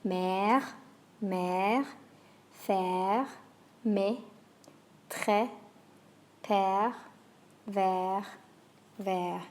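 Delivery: "Mère", "faire", "père" with a long open è; French è words. Shown as a demonstration. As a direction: let the è ring open. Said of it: "Let's hear those words one more time".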